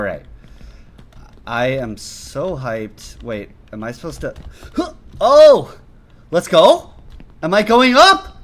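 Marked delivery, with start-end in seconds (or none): 4.8-8.4 loud, high, questioning surprise; startled cheer turning into a question; astonished excitement. Real, not acted. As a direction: exclaim it, rising in disbelief at sudden luck. Unexpected progress in the game.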